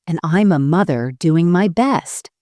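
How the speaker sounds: ordinary delivery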